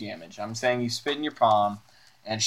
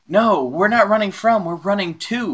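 raised, higher crowd voice; excited townsperson; 8 semitones higher, 5 dB louder than usual. Acted. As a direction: answer urgently while running past. Game master voices fleeing crowd.

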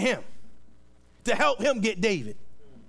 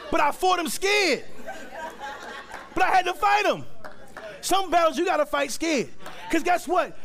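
higher and laughing through a shouted preach; 8 semitones higher than usual. Real, not acted. Preacher shouting to the church, laughing and high. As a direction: raise it up with a laugh. Preacher builds to his point.

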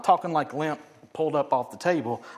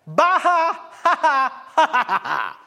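loud hammed-up jeering guffaw; big fake laugh that falls in pitch; mocking belly laugh; 11 semitones higher, 8 dB louder, slower than usual. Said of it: "they laughed hard".

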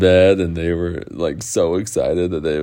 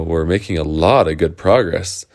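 usual speaking voice

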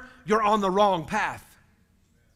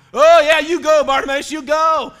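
high, loud bandwagon fan; hammed-up rooting for a new favourite; mock cheering; 5 semitones higher, 8 dB louder than usual. Fickle crowd cheering on Bartimaeus, mock cheering.

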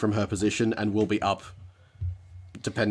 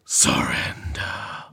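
slow, low, eerie whisper; strange disembodied voice; 9 semitones lower, much slower than usual. Acted. Guard creature demands surrender, in a strange whispered voice. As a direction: whisper it long and drawn-out, out of nowhere. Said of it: "that strange voice coming from nowhere".

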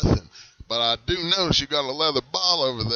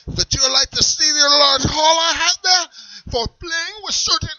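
put-on much higher, loud German accent, touchy; guard the eye patch, German accent; 9 dB louder than usual. Character keeps German voice.

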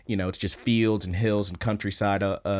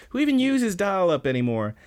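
higher-pitched, acted-out griping; mock incredulous complaint; 8 semitones higher than usual. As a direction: pitch it up in pretend disbelief. Acted complaint about dial-up.

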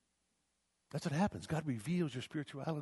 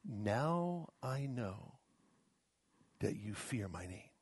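drawn out, softer and lower; slow voice of God; 3 semitones lower, 6 dB quieter, much slower than usual. Acted. God speaking to Abraham on the mountain, slow and grave. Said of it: "And God said to him".

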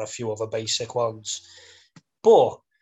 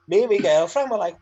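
normal talking voice

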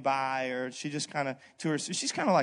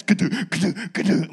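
loud mock thuds of a kicked door; imitated banging; 3 semitones higher, 8 dB louder than usual. Father kicking the garage door, loud imitated banging. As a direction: bang it out like metal being kicked.

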